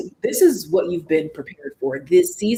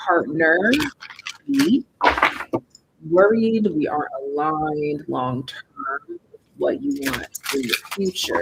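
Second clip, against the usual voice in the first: usual speaking voice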